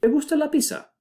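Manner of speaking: bright high question voice; question tune. Shown as a demonstration. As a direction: ask "¿Te gusta la pizza?" with high questioning pitch. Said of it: "If we wanna form questions".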